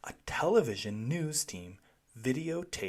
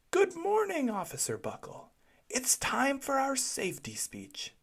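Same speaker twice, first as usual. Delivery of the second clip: cheerful principal; 13 semitones higher than usual. A narrator's reading. Principal calls the police station.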